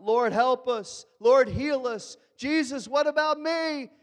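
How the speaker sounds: loud, high pleading shout, slow at first; crowd yelling. Acted. Needy crowd shouting to Jesus, high loud pleading. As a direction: cry out for help over a crowd. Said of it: "Everybody's yelling".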